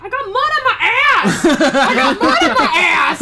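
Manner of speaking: put-on loud, high, laughing kid shout; excited yell. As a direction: yell it with glee. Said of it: "he's like".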